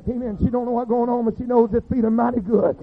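normal talking voice

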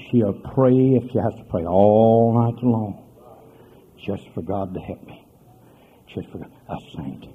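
fading, reverent close; tender hush. Acted. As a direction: sink into a tender whisper-quiet.

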